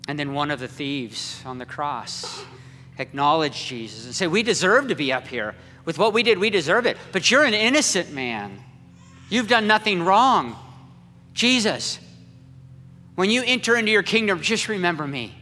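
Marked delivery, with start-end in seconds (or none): 4.3-14.4 higher, louder, rushed pleading; urgent plea. Acted. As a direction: beg him before it's too late. Thief pleading with Jesus.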